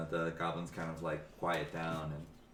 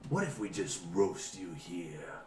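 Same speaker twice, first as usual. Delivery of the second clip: menacing ogre. Acted. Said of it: "He's like".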